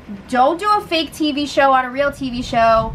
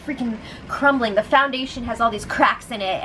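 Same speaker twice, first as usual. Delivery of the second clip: normal talking voice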